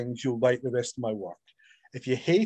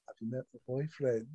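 calm, smug; 3 semitones lower, 12 dB quieter than usual. Retold or imitated. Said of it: "She just sat there with a drink going".

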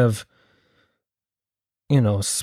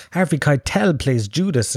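ordinary talk, nothing marked

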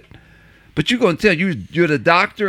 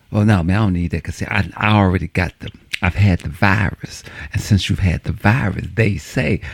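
lower, know-it-all buddy voice; buddy explaining; 8 semitones lower than usual. Retold or imitated. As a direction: explain it low and sure like the buddy. Buddy explains his immunity.

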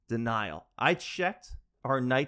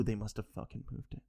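muttered accusation; 4 semitones lower, 10 dB quieter than usual. Retold or imitated. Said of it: "You go".

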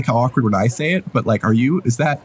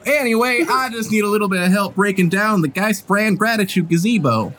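higher-pitched, folksy character voice; friendly employer character; 6 semitones higher than usual. Acted. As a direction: ask a work friend for a favour. Game master voices Ramira.

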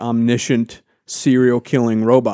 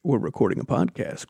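ordinary talk, nothing marked

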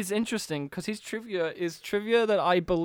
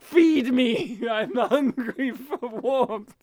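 slow, hammed-up creature voice; lizard man; 3 semitones higher, much slower than usual. Host voices actor craving warmth, slow mock lizard voice. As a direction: draw out the craving like a hungry reptile. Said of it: "He's like".

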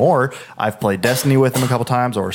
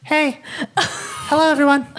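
put-on much higher, small child greeting; imitating a little kid on voice chat; 14 semitones higher than usual. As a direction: squeak it like an eight-year-old. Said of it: "talking like that".

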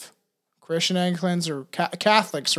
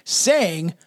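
usual speaking voice

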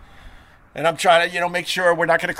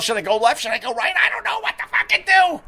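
a mildly pitched-up panicky squirrel voice, climbing much higher at the end; 14 semitones higher, faster than usual. Retold or imitated. Host voicing a confused squirrel's thoughts, pitched up and panicky. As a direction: voice a frantic squirrel that cannot decide. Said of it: "they think to themselves".

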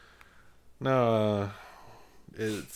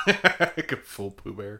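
laughing through a slow joke; slow, laughing aside; 8 dB louder, much slower than usual. Real, not acted. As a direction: chuckle your way through it slowly.

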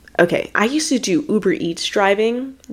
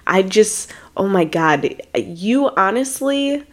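ordinary delivery

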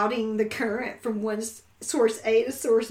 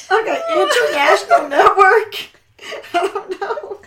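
louder, higher guess through laughter; laughing; 5 semitones higher, 6 dB louder than usual. Real, not acted. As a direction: guess while still cracking up. Guessing while cracking up.

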